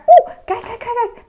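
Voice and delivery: high, laughing excitement; excited squeal. Real, not acted. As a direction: squeal with delight. Excited by smiley on bottle.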